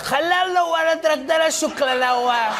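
high, slow gibberish Arabic as Trump; high hammed-up fake Arabic rant; mock Arabic. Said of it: "He'd be like".

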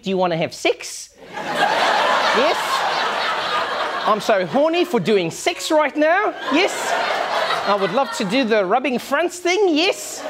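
mock New Zealand accent with lilting rises. Comedian mocking New Zealanders seducing someone, New Zealand accent. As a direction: put on an exaggerated, unsexy New Zealand accent. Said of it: "listening to a New Zealand accent".

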